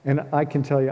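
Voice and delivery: ordinary talk, nothing marked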